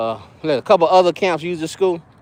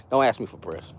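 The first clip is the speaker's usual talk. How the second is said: ordinary delivery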